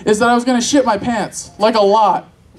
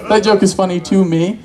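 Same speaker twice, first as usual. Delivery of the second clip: usual speaking voice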